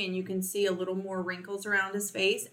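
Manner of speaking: normal talking voice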